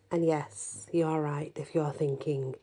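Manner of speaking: plain reading voice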